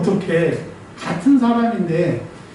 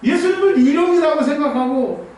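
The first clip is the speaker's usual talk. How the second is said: raised, high, fervent sermon voice; preaching at full force; 7 semitones higher than usual. Acted. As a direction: press the point home to the congregation. (열정적으로 설교하듯)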